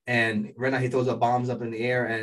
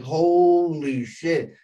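long drawn-out, higher, louder "holy"; awestruck exclamation; 5 semitones higher, 6 dB louder, much slower than usual. Retold or imitated. Fan re-enacts seeing the head fall off, drawn-out amazement. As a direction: stretch it out in amazed hype. Retells own amazed reaction.

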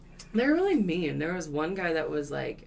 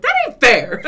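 loud, high mock complaint; whiny protest; 8 semitones higher, 13 dB louder than usual. Caller told his car will be taken, loud protest. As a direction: protest loudly like a wronged partygoer.

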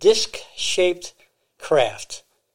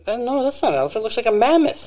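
pitched up in disbelief; astonished exclamation; 7 semitones higher, faster than usual. Retold or imitated. Pilot radioing his disbelief, astonished and high. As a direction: voice rising high as he spots it.

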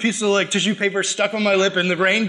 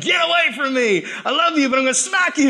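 playful sibling scolding; 6 semitones higher than usual. Retold or imitated. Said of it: "You know, like".

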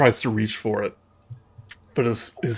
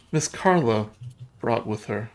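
lightly voiced ghost girl, hesitant recollection; 7 semitones higher than usual. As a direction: recount it haltingly, a hint of a little girl.

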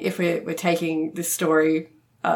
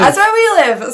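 excited; 8 semitones higher, 9 dB louder than usual. Real, not acted. Echoing excited recognition.

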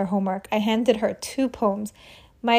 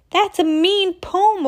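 upset yell; 9 semitones higher, 4 dB louder, slower than usual. Acted. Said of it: "a boy named Mark yelled".

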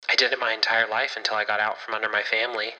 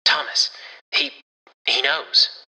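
high, breathless alarm; frightened and halting, voice pushed higher; panicked; 5 semitones higher, 4 dB louder, slower than usual. A narrator's reading. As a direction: stammer out the bad news, pitch raised. Warren bursts in panicked, out of breath.

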